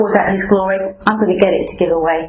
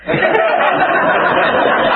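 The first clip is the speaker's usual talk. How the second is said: high laugh; 14 semitones higher than usual. Real, not acted. Laughing at own story.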